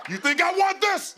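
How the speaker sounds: very high, loud indignant cry; mock outrage. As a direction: squeal it in comic protest.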